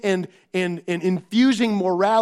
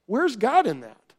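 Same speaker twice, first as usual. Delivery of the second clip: ordinary talk, nothing marked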